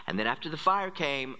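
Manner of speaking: usual speaking voice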